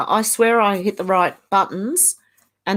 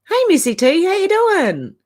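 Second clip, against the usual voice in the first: bright sing-song hello, pitched higher; cheery greeting; 10 semitones higher, 3 dB louder than usual. Real, not acted. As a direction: call out hello to a friend, pitched up. Greeting a viewer in chat.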